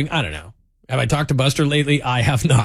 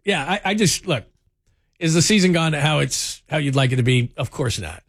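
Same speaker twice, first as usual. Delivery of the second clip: usual speaking voice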